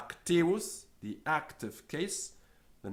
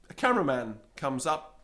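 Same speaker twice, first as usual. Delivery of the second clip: ordinary talk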